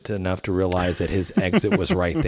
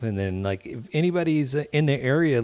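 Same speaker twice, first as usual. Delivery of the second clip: normal talking voice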